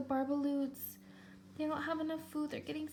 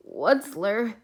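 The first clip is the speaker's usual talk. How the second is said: croaking cry; 10 dB louder than usual. Acted. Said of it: "he cried with a crofulous croak".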